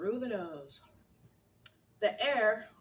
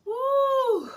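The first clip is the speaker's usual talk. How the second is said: excited woo; 16 dB louder than usual. Real, not acted. Reacting to the heat.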